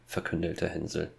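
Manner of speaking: ordinary delivery, nothing marked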